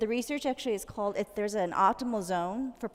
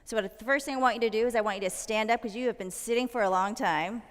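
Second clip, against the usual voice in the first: ordinary talk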